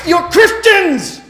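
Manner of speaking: high, loud, drawn-out shout of protest; indignant yell. Retold or imitated. Stranded father shouts at passing church bus, indignant yell. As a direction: shout it after a bus that won't stop.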